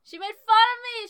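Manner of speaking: theatrically wronged, high and loud; mock-outraged wail. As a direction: cry it out with hammed-up hurt. Indignant retelling of being teased.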